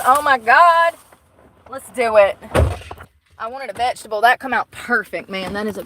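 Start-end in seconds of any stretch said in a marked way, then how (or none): 0.1-2.4 loud thrilled cry; hyped to start cooking; excited. Real, not acted. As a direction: burst out with delight. Thrilled about food.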